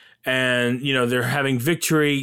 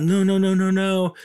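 anguished cry; 7 semitones higher than usual. Retold or imitated. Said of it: "he screams out".

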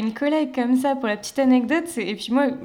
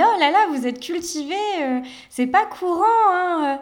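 higher, patronising surprise; condescending client; 7 semitones higher, 4 dB louder than usual. Retold or imitated. Quoting the client surprised she is cultured, mock patronising. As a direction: play a patronising lady amazed.

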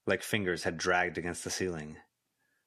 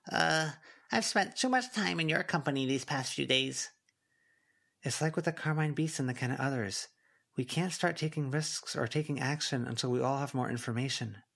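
put-on a little higher, light girl voice; girl's voice; 6 semitones higher than usual. A narrator's reading. Avery urging Snowdrop to stay together, higher voice. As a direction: lift it for the teen girls.

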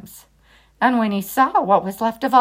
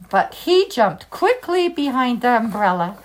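plain reading voice

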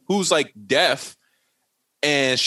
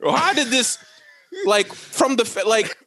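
high-pitched incredulous questioning; baffled comic rant, pitched up; exasperated disbelief; 7 semitones higher than usual. Retold or imitated. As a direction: protest the absurdity, pitch riding high. Exasperated reaction to absurd plot.

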